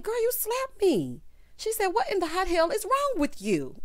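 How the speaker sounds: put-on high-pitched outraged girl; squeaky indignant voice; high squeaky quote. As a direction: pitch way up, shocked. Molly quoted after slap.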